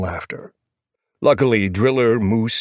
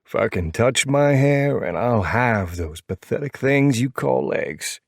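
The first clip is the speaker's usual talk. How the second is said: slow, high, drawn-out threat; stretched-out, pitched-up comeback; threatening character voice; 5 semitones higher, slower than usual. Acted. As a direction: warn him in a slow put-on voice. Princess threatens Half Pint back.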